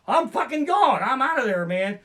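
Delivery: high, loud mock panic on the ride; a hammed-up scream of terror. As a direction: yelp it like you're being launched. Imagined coaster terror.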